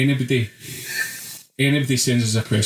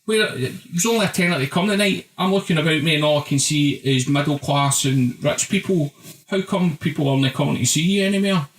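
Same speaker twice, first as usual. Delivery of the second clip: lampoon the guy asking the question, pitched up; mock East End fan voice, higher; mimicking the fan; 4 semitones higher than usual. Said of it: "my fucking hackles are up".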